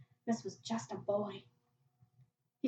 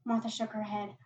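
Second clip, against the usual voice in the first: ordinary delivery, nothing marked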